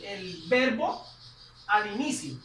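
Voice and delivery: ordinary talk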